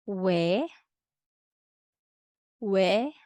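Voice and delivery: each "ye", "Yo", "Wa", "We", "Wo" said once crisp, short and clear; model drill syllables. Shown as a demonstration. Teacher models Spanish diphthongs twice each for students. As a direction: model each Spanish glide slowly for repeating. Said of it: "please repeat after me".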